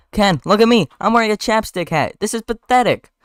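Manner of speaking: high-pitched, exasperated character voice; acted plea, pitched well up. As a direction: plead from up high in the voice. Barry pleads with Ken, acted.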